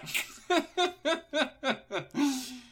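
very high evil cackle; mad scientist laugh. Acted. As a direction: cackle with glee. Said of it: "the mad scientist is still laughing".

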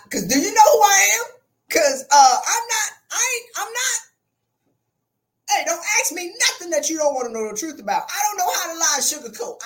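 high, loud, animated refusal; sassy high-pitched protest, acted out; loud mock indignation; retold brash refusal.

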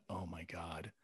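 low, hushed dread; retold panic. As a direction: say it softly under mounting alarm. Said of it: "I'm panicking".

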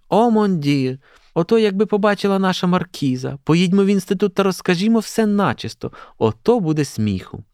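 high, gushing schoolgirl voice; gossipy delight, pitched well up; scandalised excitement. A narrator's reading. Schoolgirls planning to tell the institute everything, excited.

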